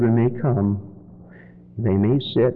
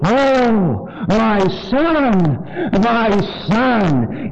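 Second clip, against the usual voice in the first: Oh, my son said as a drawn-out mournful lament; grieving lament; 8 semitones higher, much slower than usual. Shown as a demonstration. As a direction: mourn the words my son with pauses. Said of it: "I changed the tone of my voice from".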